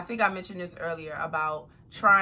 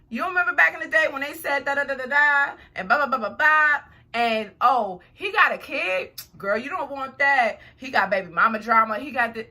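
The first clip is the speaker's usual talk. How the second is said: loud, animated mock gossip, pitched up; gossiping friend; 6 semitones higher, 7 dB louder than usual. Friend dishing dirt on a new man, mock gossip. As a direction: imitate a friend piling on the warnings, loudly.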